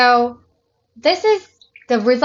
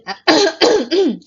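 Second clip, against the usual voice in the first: throat clearing; 8 semitones higher, 5 dB louder than usual. Real, not acted. Clears throat mid-sentence.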